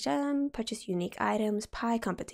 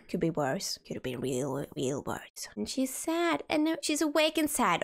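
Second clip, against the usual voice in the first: ordinary delivery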